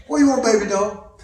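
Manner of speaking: put-on "What you want, baby doll" slurred into one quick phrase; a whole question, "What you want, baby doll", squeezed into one breath; words run together. Shown as a demonstration. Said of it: "I run mine together".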